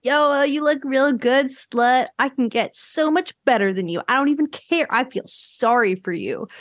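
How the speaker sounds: lightly voiced taunting teen boy; a hint of a sneering guy talking down; mocking Steven. Podcaster imitating the boy taunting Kristen, lightly sneering. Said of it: "he's like".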